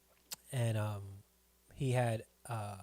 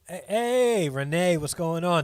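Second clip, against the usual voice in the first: higher and louder, a big hey-hey shout-out; loud excited greeting; 5 semitones higher, 8 dB louder, slower than usual. Real, not acted. Host calling out to viewer Renee, loud excited welcome. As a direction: hail a friend walking in the door.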